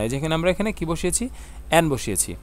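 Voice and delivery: ordinary talk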